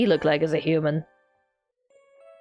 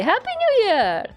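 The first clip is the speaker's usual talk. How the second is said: high, cheerful call to the teacher; cheerful greeting; 11 semitones higher than usual. Acted. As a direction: greet him brightly, much higher.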